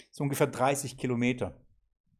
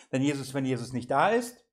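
normal talking voice

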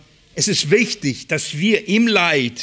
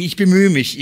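louder, pushed first take of bemühe mich; pressed emphasis; 2 semitones higher, 5 dB louder than usual. Real, not acted. Preacher assures the congregation he is trying, louder and pressed.

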